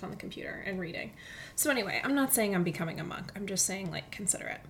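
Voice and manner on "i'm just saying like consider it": normal talking voice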